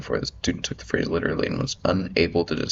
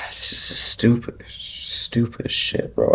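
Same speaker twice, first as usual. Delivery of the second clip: real exasperation, high and drawn out; sigh, then drag the words out slowly; exasperated; 8 semitones higher, slower than usual. Real, not acted. Reacting to people complaining just to complain, exasperated.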